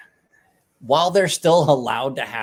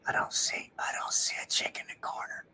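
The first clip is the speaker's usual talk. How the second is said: hushed unvoiced whisper; secretive whispered denial; 9 dB quieter than usual. Real, not acted. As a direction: whisper it so no one hears. Denies seeing the chick.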